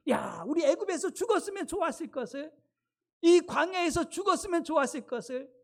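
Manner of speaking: high-pitched complaining lament of the people; acted grumbling. Israelites wish they had died in Egypt, acted grumbling. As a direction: bemoan it as the resentful crowd. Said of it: "그러면서 막 원망하는 거예요".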